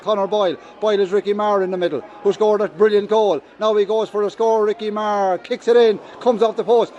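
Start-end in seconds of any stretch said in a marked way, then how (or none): none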